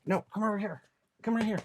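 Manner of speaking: soft, high pet-calling voice; coaxing a dog. Real, not acted. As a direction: gently call the dog over, off to the side.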